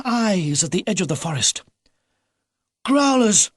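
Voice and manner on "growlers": high, loud alarm cry; shouted warning. A narrator's reading. Philip spotting eyes in the forest, shouting a loud alarm. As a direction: yell the warning at the window. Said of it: "he shouted".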